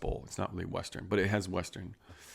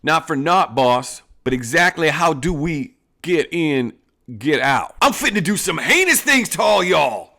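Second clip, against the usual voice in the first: loud, high hammed-up outlaw boss; Western outlaw boss; 9 semitones higher, 8 dB louder than usual. Boss bragging about his plan to the gang, in a loud, high hammed-up outlaw voice. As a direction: play the swaggering bandit chief to his gang. Boss and bandit trading lines.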